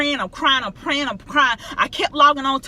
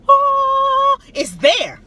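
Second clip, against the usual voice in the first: much higher, louder "Oh" of amazement; sudden amazed discovery; 6 dB louder, much slower than usual. Retold or imitated. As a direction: squeal out the surprise. Retold sudden discovery of flight.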